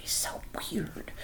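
very soft, unvoiced whisper; hushed comment to yourself; whispered aside. Real, not acted. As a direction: whisper it under your breath. Muttered aside after nonsense yelling.

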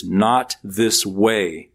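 "not this way" slow and weighted, word by word; emphatic not this way. Shown as a demonstration. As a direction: lean on every word of "not this way". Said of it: "in the Greek are very emphatic".

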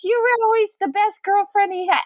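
high, lightly voiced doting mom; sweet boyfriend's mom. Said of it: "she goes".

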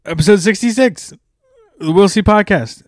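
loud, high show intro; podcast announcer. Acted. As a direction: announce the episode with a bang.

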